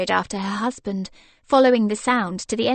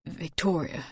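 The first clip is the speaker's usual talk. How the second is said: scratchy; 4 semitones lower, 8 dB quieter than usual. Acted. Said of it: "he said scratchily".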